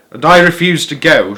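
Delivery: put-on old man announcing his refusal. A narrator's reading. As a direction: declare it stubbornly. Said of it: "announced Grandpa George".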